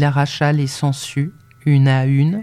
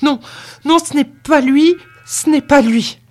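high, loud, frantic denial; crying out; 10 semitones higher, 6 dB louder than usual. A narrator's reading. Servant girl denying Jacques to her master, frantic cry. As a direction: protest desperately, voice shooting up. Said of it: "Elle s'écria".

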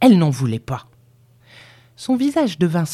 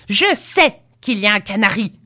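put-on higher, insistent old-woman voice; angry cry; 5 semitones higher, 3 dB louder than usual. A narrator's reading. Grandmother bangs her stick at Finch, crying angrily. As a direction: hammer out the words with indignation. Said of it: "cria-t-elle en frappant le sol".

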